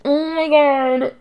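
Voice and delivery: squealing with delight; excited. Real, not acted. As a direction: gush over the news. Excited at baby reveal.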